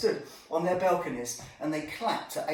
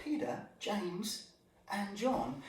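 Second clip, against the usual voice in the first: whisper; 8 dB quieter, slower than usual. Acted. Naming Jesus' closest three.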